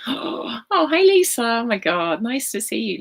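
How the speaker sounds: high, loud burst of surprise; delighted surprise. Real, not acted. Healer spotting her old mentor Lisa joining, thrilled. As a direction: greet her with a happy jolt.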